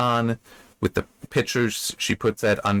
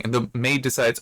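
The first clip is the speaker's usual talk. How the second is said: ordinary delivery, nothing marked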